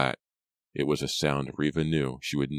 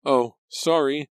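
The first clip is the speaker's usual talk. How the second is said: very high, drawn-out, loud; slow, squeaked-up apology; flustered apology; 11 semitones higher, 6 dB louder, slower than usual. Acted. Pam startled, apologizes for hurting her.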